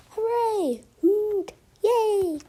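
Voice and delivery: high-pitched animal cheers; cheering. A narrator's reading. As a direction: give short, high celebratory whoops.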